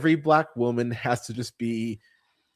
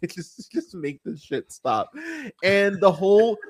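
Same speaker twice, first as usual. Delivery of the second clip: ordinary talk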